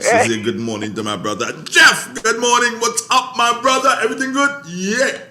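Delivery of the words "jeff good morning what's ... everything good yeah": high, loud greeting shout; yelling across the room. Real, not acted. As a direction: call it out to someone far away. Greets brother in another room.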